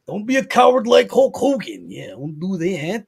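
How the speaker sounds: hammed-up wrestling promo; Macho Man impression. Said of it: "doing the Macho Man impersonation".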